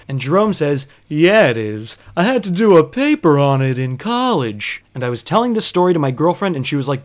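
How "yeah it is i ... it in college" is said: lightly voiced drowsy liar; a hint of a dazed, smug drone; sleepy know-it-all voice. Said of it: "Don't do that voice".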